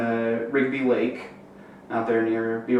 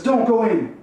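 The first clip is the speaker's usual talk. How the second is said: higher, louder urgent stop; sharp urgent plea; urgent call; 10 semitones higher, 4 dB louder than usual. Retold or imitated. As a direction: hold her back from jumping. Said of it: "he grabs her and says".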